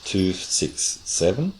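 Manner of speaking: "Two six seven" slowly, rising on "seven"; rising intonation. Shown as a demonstration. Another rising example for the interviewer. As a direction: drag it out and rise on "seven". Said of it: "The rising intonation at the end".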